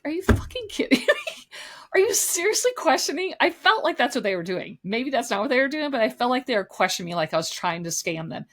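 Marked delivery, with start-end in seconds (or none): 0.0-3.3 high-pitched, laughing disbelief; incredulous reaction. Retold or imitated. Customer retelling her disbelief at the clerks, high incredulous outburst. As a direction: voice shoots way up, scandalized and half laughing.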